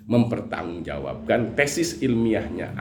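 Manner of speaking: ordinary delivery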